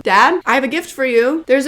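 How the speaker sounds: loud, bright call across the house. Real, not acted. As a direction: call loudly to dad in the next room. Calling out to dad.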